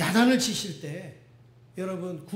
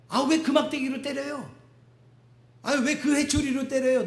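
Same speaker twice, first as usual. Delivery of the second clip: put-on whining punished child; 5 semitones higher than usual.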